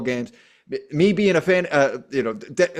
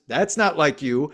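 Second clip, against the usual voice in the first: normal talking voice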